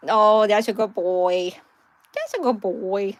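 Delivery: slow, fond praise for the dog. Real, not acted. Owner praises her dog slowly and fondly. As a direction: stretch it out lovingly.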